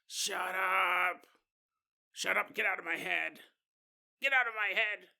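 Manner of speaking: hammed-up shout at the voices in his head; raving convict. Convict in the corner yelling at voices, hammed-up shout. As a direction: yell at the voices to leave.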